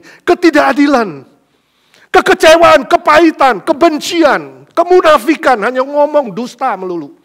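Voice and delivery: loud, high, building list; preaching at full force. Acted. Pastor rails against the world's injustice, loud preaching. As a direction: hammer each word from the pulpit.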